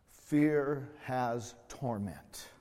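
very slow, each word weighed; preacher reading John's words with weight; slow scripture quote. Acted. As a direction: let every word of the verse land. Quoting scripture slowly.

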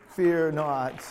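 preacher booming the promise to the congregation; proclaimed fear not. Acted. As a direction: proclaim it to the whole church. Repeated take, loud.

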